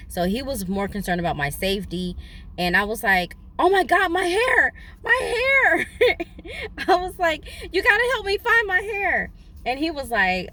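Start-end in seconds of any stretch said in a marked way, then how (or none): 3.6-5.9 high, loud wailing for her hair; hammed-up panic, voice climbing. Woman wailing to her friend about her lost wig. 5.9-6.8 crying laugh. Retold or imitated. Cry-laugh after wailing. 7.7-9.3 high, loud pleading; desperate plea. Retold or imitated. Woman begging her friend loudly to find her wig.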